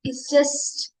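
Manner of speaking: measured meditation guide with long silences between phrases; unhurried meditation leading, spacious pauses; guided meditation. Real, not acted. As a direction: guide listeners gently into stillness, one short phrase at a time.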